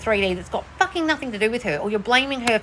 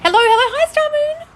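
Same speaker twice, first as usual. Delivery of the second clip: much higher, louder hello; sing-song greeting; 6 dB louder than usual. Real, not acted. Host greets a viewer in a high sing-song. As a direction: greet them like a cheery hostess.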